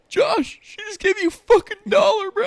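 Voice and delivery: hammed-up high kid voice, near crying; squeaky twelve-year-old voice. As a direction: squeak it out like a whiny preteen boy. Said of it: "how our voices sound".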